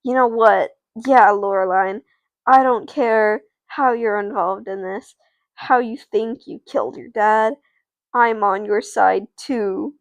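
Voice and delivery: loud, firm declaration of support; decided. Acted. Josh Maya to Loreline, making up his mind, firm. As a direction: declare loyalty, slowing at the end. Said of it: "he makes up his mind".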